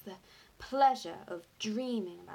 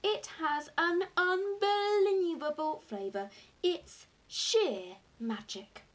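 loud, high raving, easing down at the end; savouring every word in delight; excited cry; 9 semitones higher, 5 dB louder than usual. A narrator's reading. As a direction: rave slowly and rapturously about the food. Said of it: "he cried".

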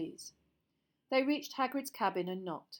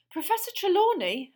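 out of breath, gasping; panting; 11 semitones higher, 11 dB louder than usual. Acted. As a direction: pant the name out. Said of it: "Harry panted".

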